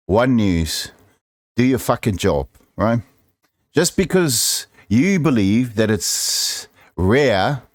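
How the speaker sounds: ordinary talk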